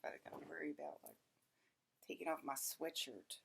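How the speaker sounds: near-silent murmur, barely voiced; hushed. Real, not acted. Talking to the camera about the heat, hushed. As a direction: keep it hushed so nobody hears. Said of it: "I can't even be myself".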